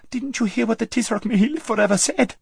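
very high, squeaky alarm; panicked protest. A narrator's reading. Lazareline warning her friend of the Tisroc, panicked. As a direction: squeal it in fright.

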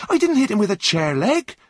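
high, loud astonished protest; surprised. A narrator's reading. Runaway denying the chair leg to the driver, surprised. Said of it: "replied John in surprise".

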